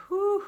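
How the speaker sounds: loud, breathy whew; big exhale. Shown as a demonstration. Guide showing listeners how to breathe out, loud whooshing exhale.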